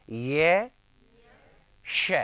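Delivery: ये drawn out and rising, then a separate hushed श; drill aloud. Shown as a demonstration. Teacher leading a sound drill, stretched ये then श. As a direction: glide up on a long ये, then release श. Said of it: "आराम से, ऊंची आवाज में".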